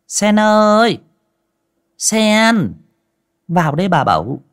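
loud high call from the back room. Acted. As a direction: call her in from another room. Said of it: "mụ gọi vọng ra". (gọi vọng ra từ buồng trong)

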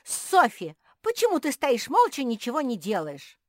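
very high, loud, urgent plea; shrieking complaint at the referee; outraged yell. A narrator's reading. Squirrel rushing to the owl, outraged yell.

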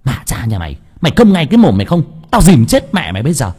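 fierce scolding that climbs into a shout; scolding yell. A narrator's reading. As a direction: bellow threats at a child, speeding up. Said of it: "vừa quát choa choa".

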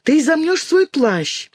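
put-on high, slow, pointed girlish reproof; scolding. A narrator's reading. Alina scolds Dinka about her cloak, high reproachful tone. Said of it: "напала на неё Алина".

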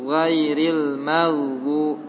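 Ghairil maghdu drawn out very slowly, vowels flowing; flowing recitation. Shown as a demonstration. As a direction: let Ghairil maghdu flow slowly and evenly. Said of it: "wa itu ngalir".

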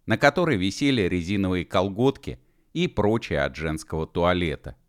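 plain reading voice

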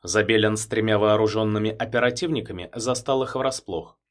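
ordinary delivery, nothing marked

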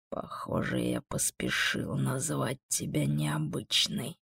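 slow, hushed musing; very quiet, pensive demon voice; thoughtful. A narrator's reading. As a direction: muse softly, taking your time. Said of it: "задумчиво произнёс он".

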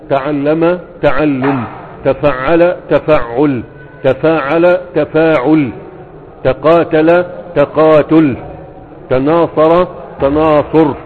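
each pattern spelled out slowly; slow drill. Shown as a demonstration. Teacher to students, drilling verb patterns, slow and deliberate. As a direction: stretch every syllable for the class.

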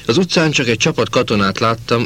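ordinary delivery

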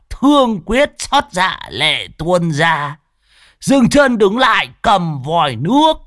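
high, slow hammed-up mournful recital; mock poem recital. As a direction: wail the verse in mock grief. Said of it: "đọc một bài thơ". (ngâm thơ than thở giả vờ, cao giọng)

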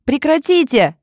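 high piercing shout; loud exclamation. A narrator's reading. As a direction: scream at him to stop. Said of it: "воскликнула Оливия". (пронзительный возглас)

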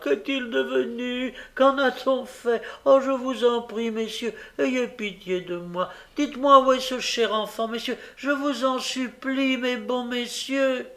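high, desperate, tearful begging; pitiful, heartrending plea. A narrator's reading. Nancy begs the gentlemen as a desperate sister, heartrending. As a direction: beg them in a wailing high voice. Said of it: "d'une voix lamentable et déchirant".

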